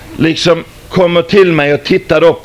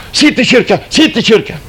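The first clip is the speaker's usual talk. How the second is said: skrikande taxichaufför; 9 semitones higher than usual. Retold or imitated. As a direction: shout it like a panicking hysterical passenger. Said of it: "som sitter och skriker". (hysteriskt skrik)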